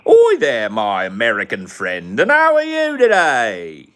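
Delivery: high, loud hammed-up British accent, slow at the end; mock British accent. Gamer mocking a Brit greeting an American friend, in a hammed-up British accent. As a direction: play an over-the-top Brit greeting a friend. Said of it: "this British thing is hard".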